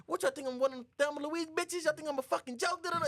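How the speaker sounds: high, indignant outburst; mock outraged bride. Bride answers Mike at the door, high mock outrage.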